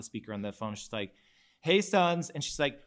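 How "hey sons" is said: higher, louder Jersey mom greeting; Jersey accent. Retold or imitated. Sean's mom on speakerphone, imitated Jersey accent. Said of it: "she says in her Jersey accent".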